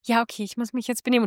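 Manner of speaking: softly reining himself in; a hushed, well-behaved inner voice; quietly telling himself to behave; dutiful inner self. Retold or imitated. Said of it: "das ist so deine innere Stimme und du bist so".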